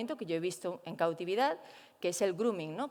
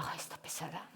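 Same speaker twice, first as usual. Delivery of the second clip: whispered, exasperated aside; annoyed whisper; 5 semitones lower, 14 dB quieter than usual. Retold or imitated. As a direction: whisper it like a fed-up chimp. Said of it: "como diciendo". (susurro fastidiado)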